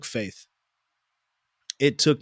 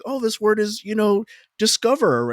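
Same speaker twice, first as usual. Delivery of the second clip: higher, chirpy mock classmate supplying the word; helpful classmate voice; 10 semitones higher, 4 dB louder than usual. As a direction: lightly play the kid who helps out. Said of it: "they would be like".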